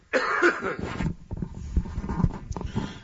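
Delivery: rough cough to clear throat. Real, not acted. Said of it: "let me clear my throat".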